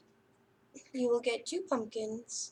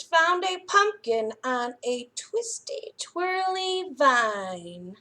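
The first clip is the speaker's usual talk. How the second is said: lively, expressive storytime read-aloud; reading to children; 6 dB louder than usual. A narrator's reading. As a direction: read it like a librarian to little kids. Reads picture book to children.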